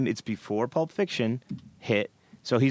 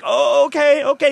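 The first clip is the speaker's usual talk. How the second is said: startled; 13 semitones higher, 9 dB louder than usual. Retold or imitated. Startled reply to bouncer.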